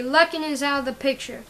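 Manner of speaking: plain reading voice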